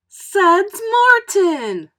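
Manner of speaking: high, slow, drawn-out gushing greeting; a teacher calling to her old pupil, high and gushing. Acted. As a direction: sing his name out like a delighted teacher. Teacher greets favorite student.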